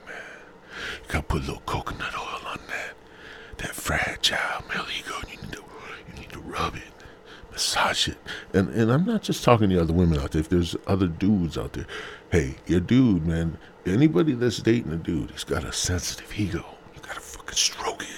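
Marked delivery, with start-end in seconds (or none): 1.0-8.2 hushed, hammed-up seduction; mock-sensual whisper. Mock-sensual advice on caressing ego. 16.9-18.1 breathy hushed urging; hammed-up intimate whisper; mock-sensual whisper. Mock-sensual advice on stroking ego.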